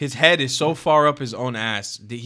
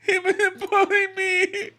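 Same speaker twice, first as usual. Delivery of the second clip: high, laughing fake sob, mostly louder; mock crying; 5 dB louder than usual. Streamer whines to chat he was bullied, laughing mock sob. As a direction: ham up being the wounded victim. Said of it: "I can't handle the bullying".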